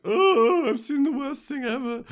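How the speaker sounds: loud falsetto wail of horror; hammed-up high-pitched despair; mock distraught wail. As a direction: wail sky-high as if traumatised. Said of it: "looking all like".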